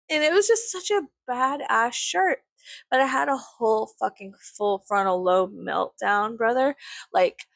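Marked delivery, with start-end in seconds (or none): 0.1-2.4 much higher, delighted; swooning praise; gushing high. Real, not acted. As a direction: gush over the shirt. Gushing about shirt.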